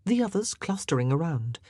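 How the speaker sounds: ordinary delivery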